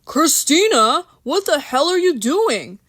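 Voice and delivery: high, loud shocked call; alarmed outburst. Acted. Bill shocked as Christina chugs vodka, loud alarm. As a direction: yell her name in disbelief.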